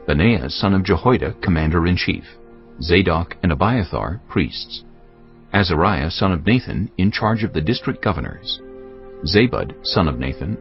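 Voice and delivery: ordinary talk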